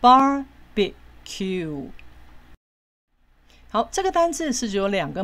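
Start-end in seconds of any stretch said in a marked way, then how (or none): 0.0-1.9 "barbecue" very slowly as bar, be, cue; three separate syllables. Shown as a demonstration. Teacher repeating the three syllables, slow, separated "barbecue". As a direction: stretch "barbecue" out, a gap after each of its three syllables. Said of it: "就这三个音节咯".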